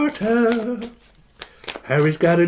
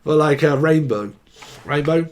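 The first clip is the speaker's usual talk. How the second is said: ordinary talk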